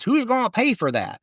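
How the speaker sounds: high-pitched, bad Iowa accent; heckler's line in a mock Iowa accent; terrible Iowa accent. A heckler in the Iowa crowd yells at Hopkins, bad Iowa accent. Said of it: "That's a terrible accent".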